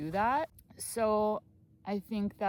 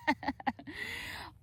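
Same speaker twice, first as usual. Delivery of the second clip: light, high chuckle; self-amused laugh; 12 semitones higher, 4 dB quieter than usual. Real, not acted. Vlogger shrugging off a possible costly mistake, light laugh.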